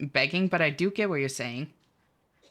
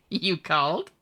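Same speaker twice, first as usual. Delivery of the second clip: ordinary talk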